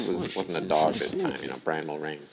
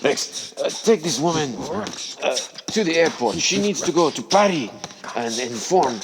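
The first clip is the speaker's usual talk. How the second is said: higher, louder acted orders; commanding dog owner; 6 semitones higher, 5 dB louder than usual. Owner sends Rex to the airport, commanding. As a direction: voice an owner dispatching his dog.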